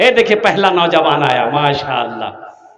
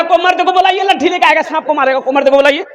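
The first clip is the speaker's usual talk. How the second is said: high mock wail, copying the women; the coward joining the women's cry; hammed-up high cry of a timid young man; 7 semitones higher, faster than usual. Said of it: "ये भी बोल रहा".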